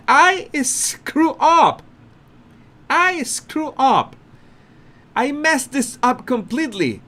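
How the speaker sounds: high, slow, panicky confession; flustered Harry. Retold or imitated. Harry admitting his slip to Colbert, high and flustered. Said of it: "and Harry saying".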